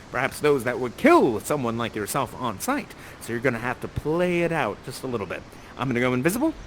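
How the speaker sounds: usual speaking voice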